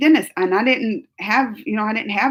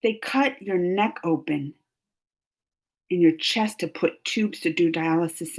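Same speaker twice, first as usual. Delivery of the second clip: normal talking voice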